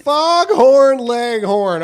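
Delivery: loud, slow cartoon drawl; Foghorn Leghorn. Host echoing co-host's accent joke, booming cartoon impression. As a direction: stretch it out big and loud. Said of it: "from attorney to foghorn leghorn".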